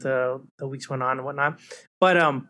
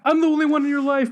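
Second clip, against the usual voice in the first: high, hammed-up clingy brother; obsessed brother; 11 semitones higher, 4 dB louder than usual. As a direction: overplay a possessive sibling. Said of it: "Where it's like".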